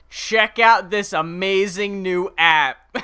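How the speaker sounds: hammed-up pitchman plugging a product; app promoter. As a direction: sell it big, stretching the words. Imitating app promoter in videos.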